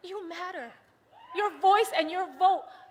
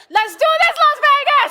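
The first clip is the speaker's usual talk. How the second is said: much higher and louder shout; rally shout; 11 semitones higher, 10 dB louder than usual. Real, not acted. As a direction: fire up the Las Vegas crowd. Rallying the crowd.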